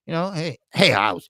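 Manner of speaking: ordinary talk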